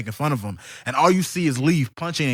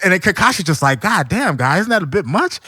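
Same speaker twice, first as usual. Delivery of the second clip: higher and louder, laughing as he goes; laughing excitement; 5 semitones higher, 4 dB louder than usual. Real, not acted. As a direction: barely hold it together telling it. Laughing, hyped retelling.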